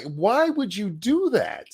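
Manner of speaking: ordinary talk, nothing marked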